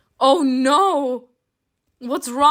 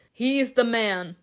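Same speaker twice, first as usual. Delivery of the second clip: ordinary talk, nothing marked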